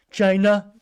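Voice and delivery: high, loud mock Trump "China", stretched out; Trump impression. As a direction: say "China" like Trump does at rallies. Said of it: "as Trump would say".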